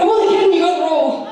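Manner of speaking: high, loud teasing reveal; tease, then burst with the good news; excited news. Retold or imitated. An agent telling her she got the role. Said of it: "She went".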